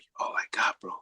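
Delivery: put-on the boy's plea, barely breathed; retold in a hushed whisper. Retelling what Shane said, softly whispered.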